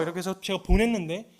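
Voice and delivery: ordinary talk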